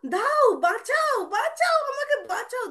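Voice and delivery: high, loud, stretched-out mock plea; cry for help. Krishna begging his brother to save him, high pleading cry. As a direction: call to be rescued in a child's high voice. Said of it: "আর দিয়ে কৃষ্ণ এরকম করছে".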